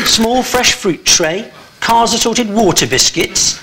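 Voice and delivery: slow, deliberate item-by-item reading; list read-out. Real, not acted. Host reading a rock star's tour rider to him, slow deliberate list. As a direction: read each item out slowly for comic effect.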